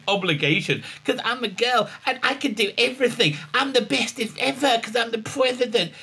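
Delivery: high-pitched put-on little-girl voice; childishly self-important, mocking; mock girly boasting. Mocking a woman who talks down to a man, high girly brag.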